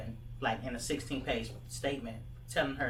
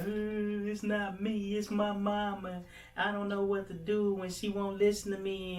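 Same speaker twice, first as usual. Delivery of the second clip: imitating a crying girl, pitched much higher and louder; 9 semitones higher, 3 dB louder than usual. Girl in the mall, crying about her mom, high tearful plea. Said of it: "And she was crying".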